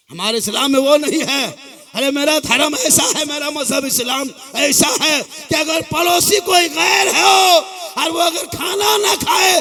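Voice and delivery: loud, high impassioned preaching; fiery preaching. Acted. Cleric to a Muharram gathering, loud impassioned preaching. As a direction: preach it out to the whole crowd. Preacher rising to full force.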